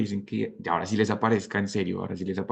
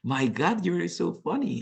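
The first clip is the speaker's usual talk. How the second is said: bright "you are so funny", pitched up; genuine delight; 9 semitones higher than usual. Shown as a demonstration. Teacher showing sincere praise to students, delighted. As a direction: sound truly amused and impressed. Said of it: "I say like".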